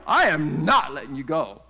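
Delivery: loud, fierce mock determination; cling on with a big loud vow; hammed-up clinging. Mary clinging to Jesus like an octopus, loud hammed-up vow. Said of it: "Like an octopus".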